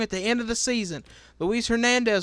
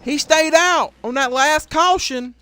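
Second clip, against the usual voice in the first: usual speaking voice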